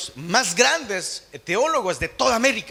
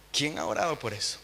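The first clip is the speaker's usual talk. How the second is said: hushed lament; 6 semitones lower, 13 dB quieter than usual. Acted. Sad answer to own question.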